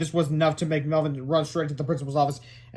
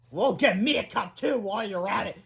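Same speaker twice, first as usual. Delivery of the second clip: growled back; 5 semitones higher than usual. Acted. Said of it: "growled back".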